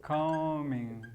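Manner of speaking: "coming" stretched long on the first syllable; drawn out. Shown as a demonstration. Teacher shows the gerund of come, drawn out. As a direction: say "coming" slowly, holding the vowel. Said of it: "Come sería".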